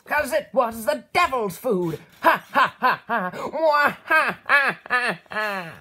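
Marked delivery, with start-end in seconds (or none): 2.2-5.7 loud cackling laughter at own joke; laughing. Real, not acted. Joke teller laughing loudly at her own church joke. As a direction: crack up at the punchline.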